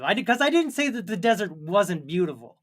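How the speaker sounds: ordinary talk, nothing marked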